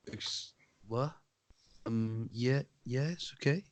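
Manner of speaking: very soft, halting, timid stammer; hesitant little voice with long gaps; mock bafflement. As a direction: imitate a thrown checkout worker, barely audible. Mimics baffled checkout worker.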